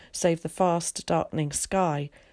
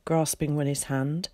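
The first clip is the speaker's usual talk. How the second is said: ordinary delivery, nothing marked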